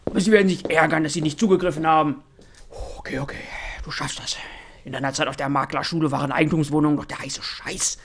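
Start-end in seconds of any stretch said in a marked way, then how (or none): 3.0-4.4 quiet self-encouragement under the breath; whispered self pep talk. Real, not acted. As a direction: whisper it to yourself, unvoiced. Pep talk to himself alone.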